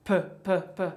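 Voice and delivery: پ as a repeated puff with no voice; voiceless p demo. Shown as a demonstration. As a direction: pop the پ out in short breaths. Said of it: "مثلاً حرف ساوند پ".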